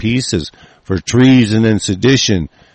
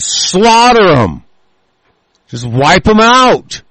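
raging yell at the enemy; furious shout; 5 semitones higher, 3 dB louder than usual. Real, not acted. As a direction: bark it out like a battle cry. Ranting call to slaughter.

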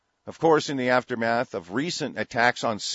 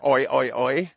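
loud, higher mocking oi oi oi; loud higher jeering chant of oi; mock oi cry; 4 semitones higher, 6 dB louder than usual. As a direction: yell the oi like a taunt.

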